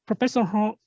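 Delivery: ordinary talk, nothing marked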